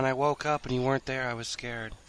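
a hint of a clingy sleepy child; mock scared whimper. Voicing clingy person to dragon.

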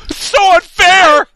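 high outraged yell of injustice; mock outraged shout. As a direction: wail it like a wronged child. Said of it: "I get emotional sometimes".

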